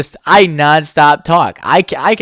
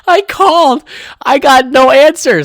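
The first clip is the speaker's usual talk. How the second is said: high, loud urgent recounting; the fright of no answer; retelling a scare, louder and higher; scared retelling; 11 semitones higher, 6 dB louder than usual. Retold or imitated. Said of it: "that was, like, the scare".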